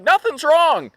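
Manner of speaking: high, loud exasperated denial; exasperated protest. Retold or imitated. Husband answering wife's worry, exasperated protest. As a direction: protest it loudly, voice shooting up.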